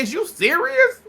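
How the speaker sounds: very high-pitched incredulous question; acted disbelief. Retelling a friend's reply to an accusation, incredulous. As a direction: act out her shock with the voice shooting up.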